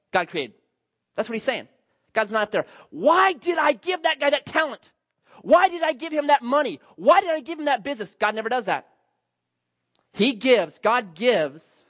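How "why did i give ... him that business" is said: higher, exasperated complaining voice; mock regretful God. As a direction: parody someone kicking themselves. Imagines God regretting gifts.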